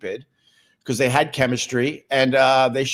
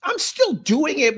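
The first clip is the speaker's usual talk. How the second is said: 1 high mock reply. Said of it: "I'm like".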